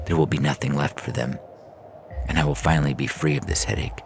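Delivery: usual speaking voice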